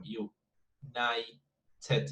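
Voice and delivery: United Kingdom broken up slowly, syllable by syllable. Shown as a demonstration. Teacher counting out syllables for students, syllable by syllable. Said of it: "it's like five syllables there".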